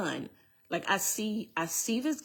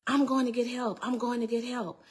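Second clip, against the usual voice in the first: higher-pitched imitation of a woman calling out; lightly voiced cry from outside; retold call for help; 6 semitones higher than usual.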